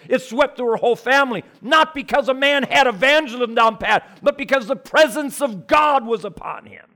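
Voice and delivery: loud, high, fired-up sermon delivery; full-force preaching. Acted. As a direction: preach it out with burning conviction.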